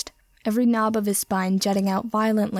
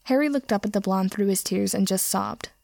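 usual speaking voice

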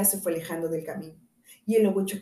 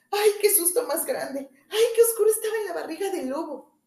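high, frightened shriek of relief; girl crying out; 9 semitones higher, 4 dB louder than usual. A narrator's reading. Caperucita cries out as she climbs out of the wolf, high and shaken. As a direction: cry out, freed from the dark belly. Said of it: "salió la niña gritando".

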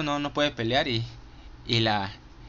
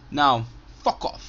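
put-on English accent quote; 4 dB louder, slower than usual. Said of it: "con el acento inglés".

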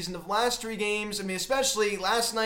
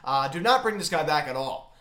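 normal talking voice

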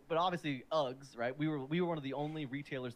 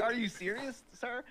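incredulous; 8 semitones higher, slower than usual. Retold or imitated. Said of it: "I was like".

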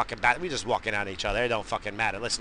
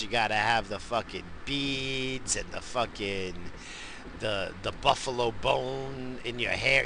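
ordinary delivery

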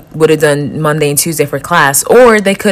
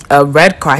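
ordinary talk

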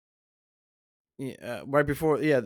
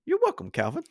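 very high put-on reply; hammed-up character sign-off, pitched way up; [name]. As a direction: answer in a high impression voice. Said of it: "Thank you, [name]".

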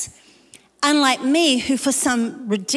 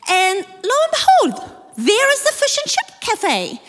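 excited discovery; 9 semitones higher, 3 dB louder than usual. Real, not acted.

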